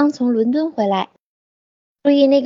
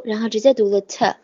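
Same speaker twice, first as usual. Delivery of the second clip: normal talking voice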